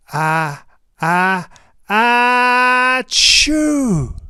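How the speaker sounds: building ah-ah-ah into a huge loud choo; enormous hammed-up sneeze; giant sneeze. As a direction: wind up and explode the sneeze.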